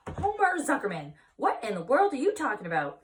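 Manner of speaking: high, loud and startled; wife bewildered at her husband's news, high and loud; bewildered outburst. Acted. Edith startled by Homer's odd news, exclaiming loudly.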